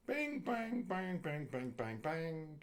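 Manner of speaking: high sing-song bing bang falling step by step; button-press noises. Retold or imitated. Someone punching in nuclear codes, playful high button beeps. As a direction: tap out imaginary buttons with your voice.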